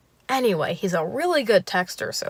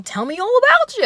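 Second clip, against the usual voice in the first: very high, loud mock eagerness; overzealous Bill Cosby; 6 dB louder than usual. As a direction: parody an overeager date's question. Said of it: "overzealous Bill Cosby meets".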